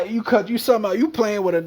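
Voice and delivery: ordinary talk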